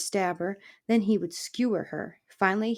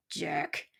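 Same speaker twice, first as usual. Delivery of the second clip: softer grumpy contempt; scornful; 3 semitones lower, 6 dB quieter than usual. A narrator's reading. Porcupine dismissing the fox, quiet scorn. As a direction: drop it out dismissively. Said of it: "Aerith said scornfully".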